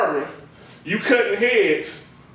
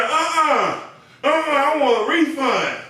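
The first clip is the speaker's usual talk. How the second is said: higher, indignant refusal; imitating an annoyed client; 5 semitones higher than usual. Client demands a refund from the barber, mock indignant. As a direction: demand the money back. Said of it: "turned around and said".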